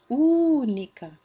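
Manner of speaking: "única" with Ú long and loud; stressed first syllable. Shown as a demonstration. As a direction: stretch the opening "ú" of "única". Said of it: "a sílaba mais forte é a antepenúltima".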